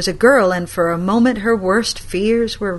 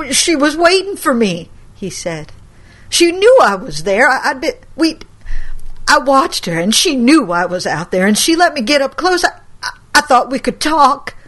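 higher, louder stammering pleas; anxious excuses; 6 semitones higher, 5 dB louder than usual. Acted. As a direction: justify himself, frightened. Grandson pleading excuses.

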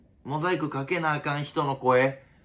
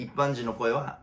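normal talking voice

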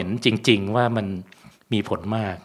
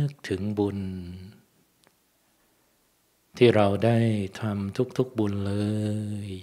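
guided meditation; 2 semitones lower, 7 dB quieter than usual. Acted. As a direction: ease the listeners into stillness. Leads blessing meditation.

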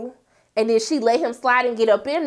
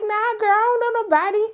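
lightly voiced lawyer; 9 semitones higher than usual. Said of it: "He was like".

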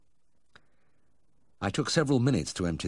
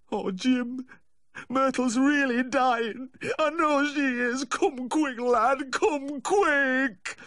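very high, wailing plea; distraught caller in a high, tearful-sounding voice; panicked pleading; 4 dB louder, much slower than usual. Acted. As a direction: beg desperately for help, stretching the words. Distraught dog owner begs vet.

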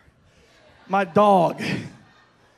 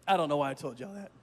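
ordinary talk, nothing marked

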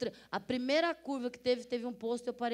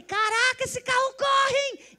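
much higher and louder, astonished; amazed exclamation; 14 semitones higher, 10 dB louder, slower than usual. Retold or imitated. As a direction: gasp out in disbelief at the speed. Amazed at car speed.

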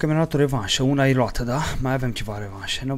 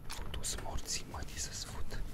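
whispered curse; 14 dB quieter than usual. Real, not acted. Player hunting his killer, whispering a curse. Curses under breath while searching.

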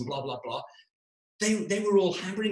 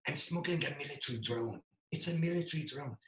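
softer, with a lightly put-on voice for the friend; secretive confession; 8 dB quieter than usual.

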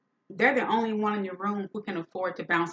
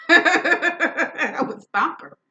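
higher, louder, slow and laughing; laughing threat; 9 semitones higher, 10 dB louder, much slower than usual. Real, not acted. Repeating her threat about the sister, laughing. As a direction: stretch it out through a laugh.

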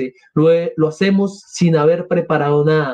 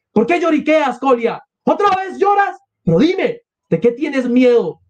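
exasperated scolding; 9 semitones higher than usual. Acted. Mother scolding crying Kolia.

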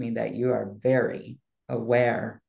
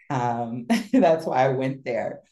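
ordinary delivery

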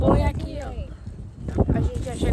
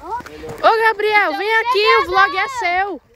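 calling out; 6 semitones higher, 4 dB louder, slower than usual. Real, not acted. Calling Gabriel over.

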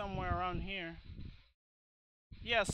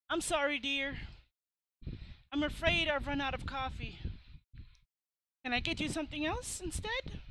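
put-on higher-pitched, polite old lady; apologetic old lady; 7 semitones higher, 3 dB louder than usual. Old lady out of coffee apologising to her visitor, high-voiced. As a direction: apologise sweetly as an old hostess.

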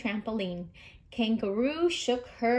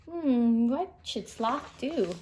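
ordinary delivery, nothing marked

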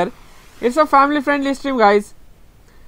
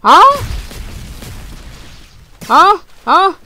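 startled huh; 7 semitones higher, slower than usual. Real, not acted. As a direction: blurt out a high, startled huh. Startled mid-game, high loud huh.

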